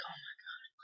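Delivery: imitating a dad under his breath; voiceless mock-exasperated whisper; whispered dismay. Dad reacting to his son's lie, whispered dismay. Dad's horrified aside.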